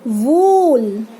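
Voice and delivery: वूल with a very drawn-out oo; long u. Shown as a demonstration. Teacher models the big u, वूल stretched long. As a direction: hold the vowel in वूल slowly. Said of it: "ये longer है".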